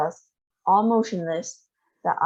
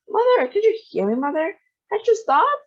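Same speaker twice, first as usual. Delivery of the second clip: high, loud desperate calling; frantic wail; 13 semitones higher, 4 dB louder than usual. A narrator's reading. Mother wailing at her vanishing mother. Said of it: "wailed Missus Bucket".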